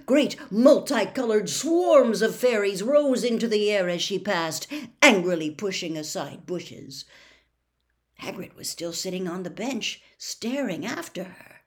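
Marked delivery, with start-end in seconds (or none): none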